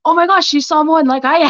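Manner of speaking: gushing, high-pitched recognition; excited realization. Retold or imitated. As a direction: relive a thrilled discovery. Reliving her excited discovery of author.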